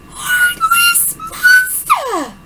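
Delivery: high, very slow, loud wail; dramatic cry. Acted. Calling the father a heartless monster, drawn-out high wail. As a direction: fling the words out like a soap heroine.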